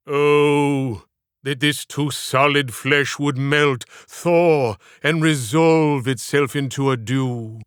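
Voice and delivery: slow, grieving stage Hamlet; a broken soul longing for its end, slowly; Hamlet soliloquy. Acted. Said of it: "Edwin's voice projected from a broken soul".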